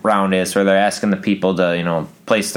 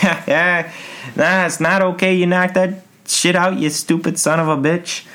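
higher, mocking taunt; mocking retort; 8 semitones higher than usual. Narrator taunting the contestant, higher mocking voice. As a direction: taunt the loser with glee. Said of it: "I'd be like".

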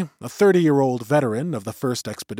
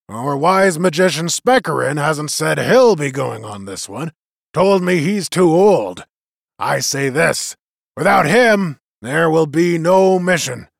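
lightly voiced veteran, raised and rousing; rally the crowd like an old war chief; leader's address; 4 semitones higher, 7 dB louder than usual. A narrator's reading. Ruggum rallies the gathering, lightly raised.

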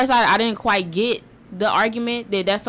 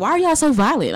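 higher mocking voice; mock clueless person; 6 semitones higher than usual. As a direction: ham up a clueless bigot. Mock racist stereotype.

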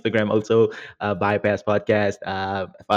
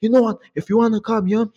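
mock angry; 8 semitones higher, faster than usual. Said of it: "the guy got mad".

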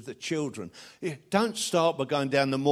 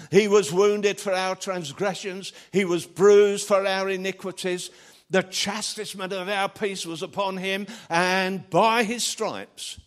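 loud, slowing scripture quote; scripture recitation; 6 semitones higher, 4 dB louder than usual. Acted. Preacher quoting Isaiah to the congregation, loud and slowing. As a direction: declaim it, slowing on the last words.

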